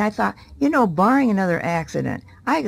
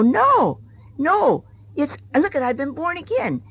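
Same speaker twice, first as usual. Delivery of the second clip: eager protest; 8 semitones higher than usual. Retold or imitated. Woman protesting to her pastor about being born again, excited. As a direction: burst out in eager disagreement.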